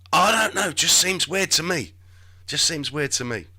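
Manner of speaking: usual speaking voice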